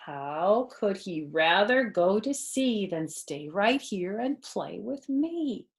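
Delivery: plain reading voice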